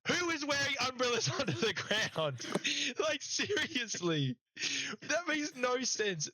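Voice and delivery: high, slow disbelieving scoff; incredulous. Real, not acted. Host mocking wet-stadium complaint, high slow incredulity. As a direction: ask it like it's the dumbest thing you've heard. Mocking the players' umbrella quote.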